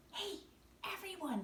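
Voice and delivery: ordinary delivery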